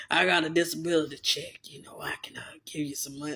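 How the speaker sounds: low, soft, whispered creepy offer; a sleazy old man whispering money; imitating Mister Luther.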